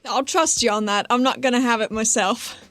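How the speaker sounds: ordinary talk, nothing marked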